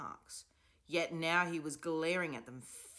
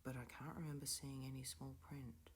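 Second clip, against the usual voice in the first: murmured; 11 dB quieter than usual. A narrator's reading. Stanley continues murmuring.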